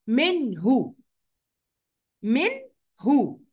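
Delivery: "منه" with a short clear n before the haa, no held hum; clear noon. Shown as a demonstration. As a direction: say the n of "منه" distinctly, then the haa. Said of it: "هظهرك عشان أنت اللي جاي وراكي هاء".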